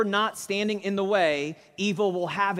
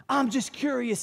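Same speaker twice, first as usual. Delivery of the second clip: high-pitched, animated retort; 7 semitones higher than usual. Retold or imitated. Gang leader challenges John, animated and raised in pitch. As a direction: act out a worked-up gang leader, voice lifted. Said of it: "he got real animated".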